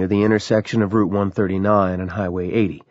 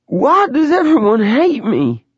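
slow, much higher, louder wailing sob; sobbing; 5 dB louder, slower than usual. A narrator's reading. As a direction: wail it through tears. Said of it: "she sobbed".

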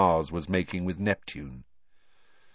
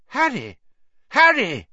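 urgent shouting; 7 dB louder than usual. A narrator's reading. Friend shouting him awake.

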